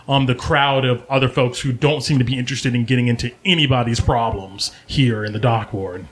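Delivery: ordinary talk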